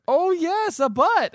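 high-pitched, gleeful exclamation; mock delight. Co-host voices Himmel opening the door to a butt, mock delight. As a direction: squeal it with hammed-up delight. Acted excited character accepting silly offer.

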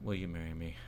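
soft, deflated proposal; a man proposing with no hope left; defeated. Retold or imitated. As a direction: ask it already beaten, quietly. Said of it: "So defeated already".

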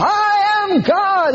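high, drawn-out proclaiming cry; mock Shirley MacLaine. Preacher mocking an actress on the seashore, drawn-out high cry. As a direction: stretch it out like a grand revelation. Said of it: "trying to convince herself".

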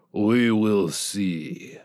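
put-on slow, heavy Russian accent with a cold threat; Ivor's Russian accent. A narrator's reading. Ivor tells dying Bob he will fix then kill him, slow Russian menace. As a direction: promise death slowly, in thick Russian.

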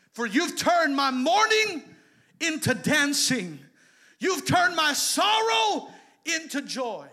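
loud, high, slow proclaimed verse; preached scripture. Acted. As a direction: cry the psalm out. Shouted scripture reading.

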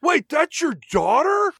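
loud, high, slow outraged disbelief; mock shock. Jake realising the rich man is her father, loud mock shock. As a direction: blurt it out, scandalised.

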